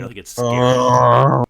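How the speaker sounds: a scared creature calling out. Acted.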